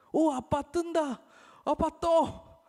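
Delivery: high, loud, slow delighted cry; excited child. Acted. Child discovering he floats, thrilled high cry. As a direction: shout with joy at floating.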